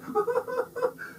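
high delighted giggle; high laugh. A narrator's reading. Missus Sharp laughs.

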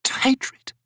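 high, unvoiced, broken whisper; ragged whisper. Old Maibi trailing off to Crone in a ragged whisper. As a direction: let the last words crumble into a whisper. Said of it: "she finished in a ragged whisper".

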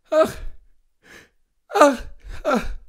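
high, loud moans of pain; acted sick man's anguished moans; groaning in pain. A narrator's reading. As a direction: groan each "ach" separately, with pauses. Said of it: "stöhnte der Kranke".

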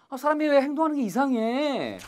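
high-pitched complaint; acted exasperation. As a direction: complain about someone acting weird. Acted complaint about odd behaviour.

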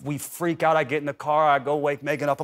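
ordinary talk

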